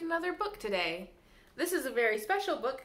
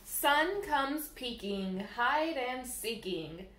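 lilting, sing-song storybook reading; bouncy picture-book read-aloud; reading to children; much slower than usual. Acted. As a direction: read the rhymes to little ones at storytime. Reads rhyming picture book aloud.

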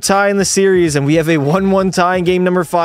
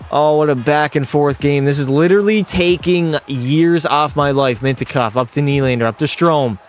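usual speaking voice

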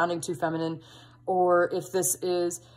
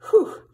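teary laughing exhale; 13 semitones higher, 6 dB louder than usual. Real, not acted. Overwhelmed after the emotional card.

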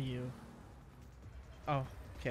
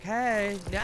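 loud, higher-pitched mimicked cry; mock protest yell; 5 semitones higher, 5 dB louder than usual. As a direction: cry out in playful imitation of a doomed character. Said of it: "he's like".

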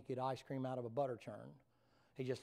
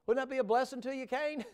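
excited teasing; 11 semitones higher, 7 dB louder than usual. Acted. Preacher teasing Cain.

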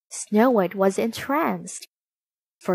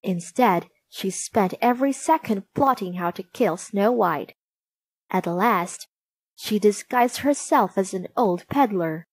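plain reading voice

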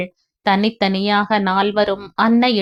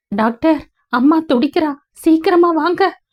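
urgent plea; 7 semitones higher than usual. Acted. Urgent call to doctor.

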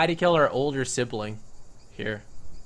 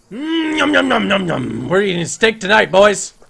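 gloating yum; 5 semitones higher, 10 dB louder than usual. Real, not acted. Gloating while eating.

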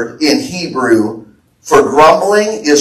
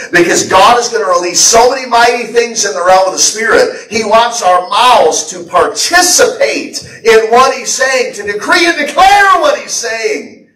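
rising fervor; 6 semitones higher than usual. Real, not acted. Preaching builds to climax.

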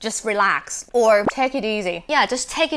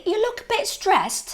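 concerned friend; 10 semitones higher, much slower than usual. Acted. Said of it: "You okay ไหมอะ".

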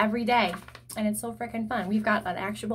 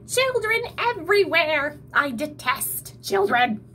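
high, loud troll ranting, slowing at the end; troll raging at the children; shrieking troll; 6 semitones higher, 4 dB louder than usual. A narrator's reading. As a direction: spit out your hatred of children. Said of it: "shrieked the troll".

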